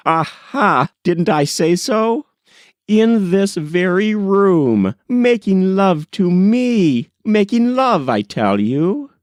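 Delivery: triumphant mock scandal, rising to a squeal on love; excited teasing cry. A narrator's reading. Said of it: "cried she".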